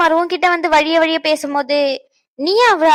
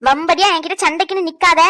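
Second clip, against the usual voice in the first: ordinary talk, nothing marked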